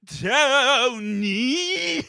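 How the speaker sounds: high, loud call across the house; from another room. Retold or imitated. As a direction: call out to someone rooms away. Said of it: "Judy from the other room, I hear him go".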